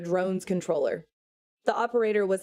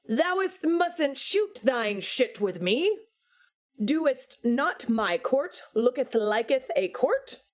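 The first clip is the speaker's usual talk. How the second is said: higher, slow, pompous archaic voice; hammed-up old English God; 6 semitones higher than usual. God lecturing Satan in ridiculous thee-and-thou speech. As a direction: ham up fake Shakespearean grandeur. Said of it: "Can you cut it out? You don't talk like that".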